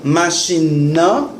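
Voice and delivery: Machine na said slowly for repeating; slow, clear model for the class; slow repeat-after-me demo. Shown as a demonstration. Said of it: "Repite après".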